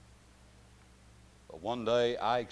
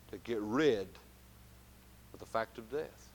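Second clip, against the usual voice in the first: ordinary delivery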